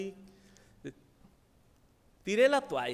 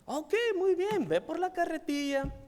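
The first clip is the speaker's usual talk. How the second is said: a lightly voiced kind pastor, higher and brighter in pitch; friendly pastor; 9 semitones higher, 3 dB louder than usual. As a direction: gently play the welcoming pastor. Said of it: "llegó el pastor muy amable".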